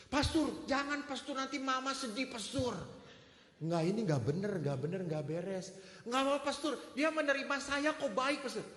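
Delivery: put-on high, loud pleading young woman; begging young woman. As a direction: beg the priest not to. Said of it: "girl begging the priest". (perempuan muda memohon, suara tinggi)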